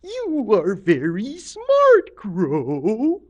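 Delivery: slow, loud, swooping flattery; Octopus Lady. Acted. Octopus Lady flattering Crow, slow swooping voice. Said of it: "and she said".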